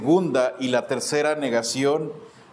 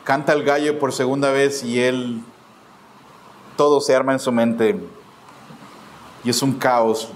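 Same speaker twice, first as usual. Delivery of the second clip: preaching at full force; 4 semitones lower, 3 dB louder than usual. Acted. Preacher to congregation on Peter's fall, loud and heavy. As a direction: drive home Peter's ruin with weight.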